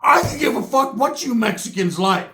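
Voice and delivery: high, shouted imitation of a dismissive retort; mock angry retort. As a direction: throw it back as a mock yell. Acted retort in a quarrel.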